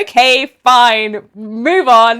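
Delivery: slow, drawn-out mock compliance; exaggerated resigned okay; grudging acceptance. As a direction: give in reluctantly, word by word. Retold grudging acceptance of note.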